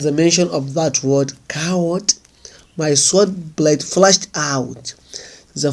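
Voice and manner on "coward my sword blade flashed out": usual speaking voice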